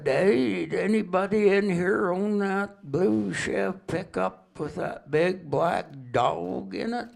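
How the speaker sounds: high, slow Southern drawl calling into the store; slow Tennessee drawl. Retold or imitated. Stranger walks into the corner store, asks in a slow Tennessee drawl. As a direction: stretch the words out like a Tennessee country man. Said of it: "they talk kinda slow and drawl down there".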